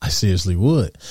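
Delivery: normal talking voice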